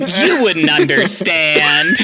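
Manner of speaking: hammed-up imitation of a TV ending; mock dramatic quote. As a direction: stretch it out like an overacted final line. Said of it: "And then he said".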